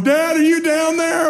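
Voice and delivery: put-on high-pitched little-girl yell, drawn out; child's scream imitated. Daughter calling down to dad from her bedroom, imitated high yell. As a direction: holler downstairs like a small kid. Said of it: "hear Natty screaming from her room".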